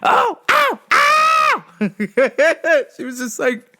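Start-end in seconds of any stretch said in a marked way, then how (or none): 0.0-1.6 much higher, louder pained yells; Kinison-style scream. Retold or imitated. 1.7-2.9 loud, high laugh; high cackle. Real, not acted. Laughing at own story.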